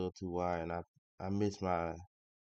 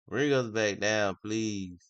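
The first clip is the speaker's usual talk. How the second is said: loud, pleading with the market; insistent plea; 2 semitones higher, 8 dB louder, slower than usual. Real, not acted. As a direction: repeat the request louder, urging it on. Louder repeated plea to the market.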